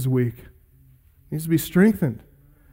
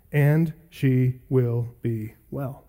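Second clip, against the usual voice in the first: tender promise; 5 semitones lower, 6 dB quieter, much slower than usual. Acted. Quoting Jesus' promise softly.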